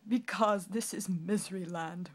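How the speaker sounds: loud tearful explanation; king weeps his answer; weeping. Acted. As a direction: weep the reason. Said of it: "wept the king".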